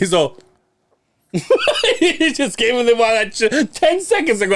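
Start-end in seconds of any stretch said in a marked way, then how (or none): 1.3-4.6 high laughing disbelief. Real, not acted. Laughing at retold beer theft.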